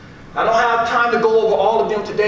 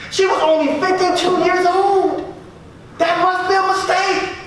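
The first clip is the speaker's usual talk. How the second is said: angry at God; 8 semitones higher than usual. Retold or imitated. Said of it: "I was angry at God".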